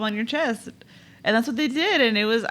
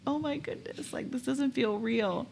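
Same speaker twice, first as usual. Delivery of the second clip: higher, much softer and slow; hushed awe; 6 semitones higher, 7 dB quieter, slower than usual. Retold or imitated. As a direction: marvel at it under your breath. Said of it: "you're like".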